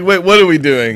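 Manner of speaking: sudden panicked question; mock alarm, loud and high.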